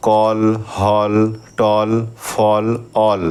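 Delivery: কল and হল drawn out slowly with a round অ sound; slow vowel demo. Shown as a demonstration. Teacher showing the অ sound, slow clear demo. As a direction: stretch the অ in কল, হল, টল, ফল, অল. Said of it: "অ এর মতো হচ্ছে".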